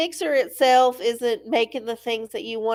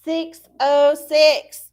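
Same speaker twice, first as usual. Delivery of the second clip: slow, louder reveal; dramatic reveal; 5 semitones higher, 6 dB louder, much slower than usual. Real, not acted. Revealing the generated page number slowly. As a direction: draw out each number. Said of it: "reading the number dramatically".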